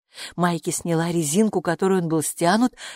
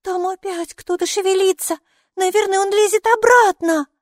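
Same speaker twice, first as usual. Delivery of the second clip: high voice growing louder; excited child; 14 semitones higher, 6 dB louder than usual. A narrator's reading. Майки feels something climbing back, high excited child voice. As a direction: build to a high, loud child's alert.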